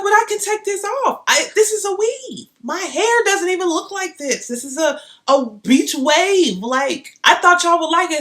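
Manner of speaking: high and loud, hurt and defensive; indignant pleading. Retold or imitated. Explaining her beach-wave weave to the rep, high and hurt. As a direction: protest it's only a weave.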